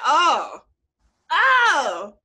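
loud, drawn-out oh of pride; amazed delight. Retold or imitated. Reliving getting her own car.

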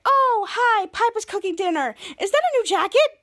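high-pitched put-on cheeriness; imitated over-cheerful greeting; forced happy. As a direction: greet them trying hard to sound happy. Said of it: "try to be so happy".

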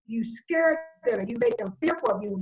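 usual speaking voice

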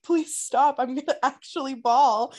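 hammed-up near-tears plea; mock pleading. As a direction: beg as if about to bawl, half laughing. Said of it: "I was like".

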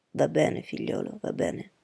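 low, softening reassurance; calming father. A narrator's reading. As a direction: settle an agitated son gently, voice dropping. Padre calma il figlio agitato. (padre che rassicura, voce bassa)

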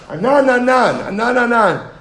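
high, loud mocking singsong; mocking repetition. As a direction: throw the name back scornfully. Mocking the insulting address.

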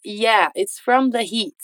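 slow statement falling at the end; slow model line. Shown as a demonstration. Teacher models James's answer slowly. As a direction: say it slowly and let it fall. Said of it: "Ele finaliza a fala dele com".

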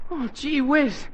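ordinary talk

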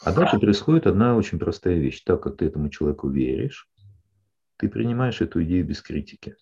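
usual speaking voice

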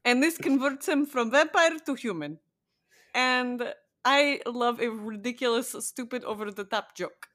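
a light, hammed-up Eastern European vampire accent; a podcaster playing a crappy Eastern European vampire, lightly. Co-host chatting about a vampire show, in a mock Eastern European vampire accent. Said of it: "funny Eastern European crappy vampire accent".

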